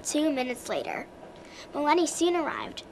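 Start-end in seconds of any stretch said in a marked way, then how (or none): none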